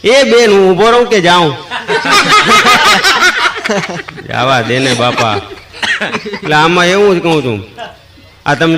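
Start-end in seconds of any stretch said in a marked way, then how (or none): 0.0-1.6 high, loud call to the women; mock yell, pitched high. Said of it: "કાકાએ રાડ પાડી કે". 1.6-4.2 very high laughter; real laugh at the punchline; high falling laugh. Real, not acted. Laughing at own joke.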